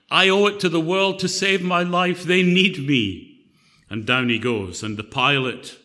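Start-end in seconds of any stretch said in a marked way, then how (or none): 0.1-3.4 pompous, louder and higher; pompous genius. Acted. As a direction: puff up like the self-important smartest man alive. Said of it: "after saying".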